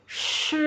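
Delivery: "sh" hissed long with tongue on the palate; retroflex SH. Shown as a demonstration. Teacher showing how SH is said, retroflex. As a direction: curl the tongue up for a drawn "sh". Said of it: "letak lidahnya di langit-langit".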